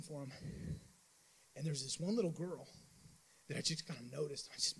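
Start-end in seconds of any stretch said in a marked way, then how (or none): none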